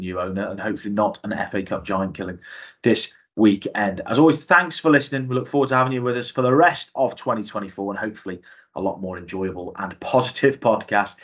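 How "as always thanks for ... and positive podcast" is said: podcast outro. Acted. Presenter outro to listeners.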